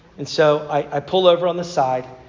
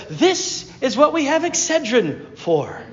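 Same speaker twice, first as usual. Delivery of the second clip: commercial voice; 6 semitones higher, slower than usual. Retold or imitated. Mimicking a painkiller commercial.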